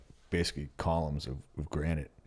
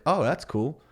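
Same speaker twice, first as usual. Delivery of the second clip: high, bright reaction; excited; 9 semitones higher, 4 dB louder, slower than usual. Retold or imitated. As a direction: act pleasantly surprised. Retold reaction, high and louder.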